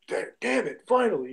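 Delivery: acting out an annoyed ref; mock mad. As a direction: curse in retold frustration. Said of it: "and he was mad".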